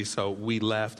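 ordinary talk, nothing marked

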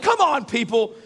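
hammed-up fed-up passenger; exasperated plea. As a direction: implore the staff in frustration. Acted plea to airline staff.

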